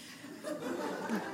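a quick, silent-breath chuckle; soft breathy laugh. Real, not acted. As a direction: let a little airy laugh slip out. Laughing at the memory.

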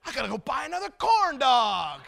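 mock exasperated shout; exasperated dad. Dad answers his left-out daughter, mock exasperated shout. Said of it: "I got that dad attitude".

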